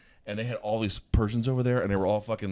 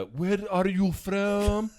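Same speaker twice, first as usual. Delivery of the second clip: slow, high mock Persian accent, drawn out; imitating Persian accent; 11 semitones higher, 5 dB louder, much slower than usual. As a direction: lay on a thick Persian accent, slowly. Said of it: "their accent was like".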